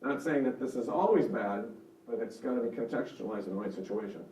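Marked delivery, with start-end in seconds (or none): none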